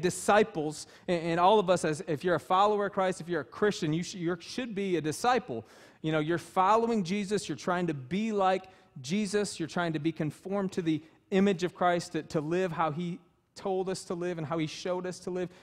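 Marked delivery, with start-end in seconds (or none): none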